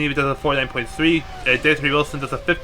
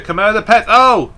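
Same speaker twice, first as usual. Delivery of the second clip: sharp, loud, higher oh; surprised shout; 6 semitones higher, 8 dB louder than usual. Real, not acted. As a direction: blurt it out as it happens. Sudden crash seen live.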